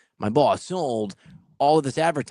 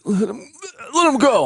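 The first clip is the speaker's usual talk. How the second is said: louder and much higher, pleading; urgent plea; 10 semitones higher, 6 dB louder than usual. Retold or imitated. Reed begging him to let Laettner take the car, loud and high. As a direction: urge a friend to back off.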